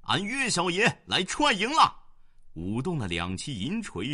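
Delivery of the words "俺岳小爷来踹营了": high, loud boast as he charges; loud battle cry. A narrator's reading. Young general shouting his arrival at the enemy. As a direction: bellow his name at the enemy camp. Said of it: "大叫道".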